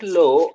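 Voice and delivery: "lo" with a round O, high and loud; wrong rounded O. Shown as a demonstration. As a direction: punch "lo" as the mistake to avoid. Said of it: "It is not the O round".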